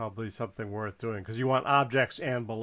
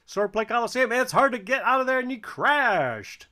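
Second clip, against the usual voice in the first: excited; 11 semitones higher, 7 dB louder than usual. Real, not acted. Tense moment in the game.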